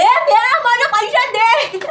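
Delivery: high, loud and very slow demand; mock the driver dragging out "मुझे पैसे दे"; auto driver. Auto driver insisting she pay him, high, loud and slow. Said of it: "उसने इतना ऐसा बोला".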